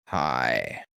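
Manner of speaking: low, soft, slow; creepy Freeze voice. Retold or imitated. Voice actor turning when called Freeze, low and creepy. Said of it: "he'll just slowly turn".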